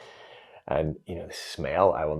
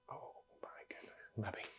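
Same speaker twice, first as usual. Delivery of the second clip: breathless whispered realization; awed whisper; 8 dB quieter than usual. Retold or imitated. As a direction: whisper it in quiet awe. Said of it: "sitting in the grass going".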